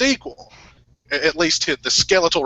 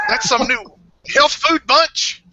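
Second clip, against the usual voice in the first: high, slow character voice; 10 semitones higher, slower than usual. Retold or imitated. Quoting a film character.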